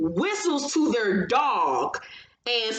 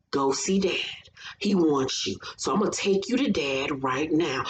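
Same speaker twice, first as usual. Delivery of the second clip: a touch lower, lulling coo to a partner; creepy soothing baby talk; 3 semitones lower than usual. Retold or imitated. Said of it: "talking to her like a baby ... soothing".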